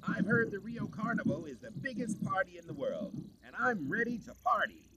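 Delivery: Grandmaster Glitch as a gleeful, scheming villain; smug, showy villain eager to party. Acted. Villain Glitch boasting to his Grimbots, gleeful.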